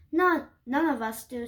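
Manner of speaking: ordinary delivery, nothing marked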